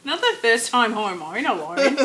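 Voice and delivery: playful mock Aussie; hammed-up Australian, rising at the end; Australian accent. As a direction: put on broad Australian vowels. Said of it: "you and doing that accent".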